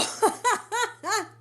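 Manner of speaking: bright high burst of laughter. Real, not acted. Laughs at eating gloss.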